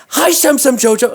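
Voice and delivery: high, loud greeting; kids calling out. Retold or imitated. Kids greeting him in the school, high loud call. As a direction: imitate excited kids hailing someone across a hall.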